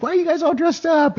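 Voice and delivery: high mock curiosity; mock coworkers. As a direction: squeal it like a nosy coworker. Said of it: "everyone's like".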